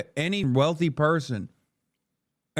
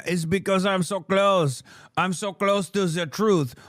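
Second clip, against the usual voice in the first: ordinary delivery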